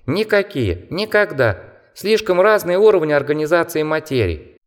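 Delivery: high squeaky mocking bat. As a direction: chatter like a scornful little bat. Bat character explains.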